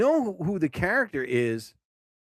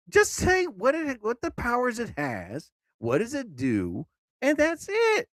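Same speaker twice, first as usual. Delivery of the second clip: pitched way up, whiny "Just say what it has"; exasperated whine; 9 semitones higher than usual. Real, not acted. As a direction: complain like a fed-up reader. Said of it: "frustrated at long text".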